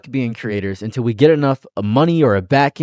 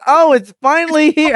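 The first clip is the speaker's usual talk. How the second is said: excited relief; 12 semitones higher, 5 dB louder than usual. Retold or imitated. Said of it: "And I was like".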